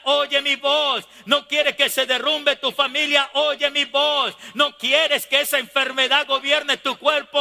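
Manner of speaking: refrain climbing to a high, impassioned cry; insistent preaching. Acted. God warning his people to listen, insistent preaching.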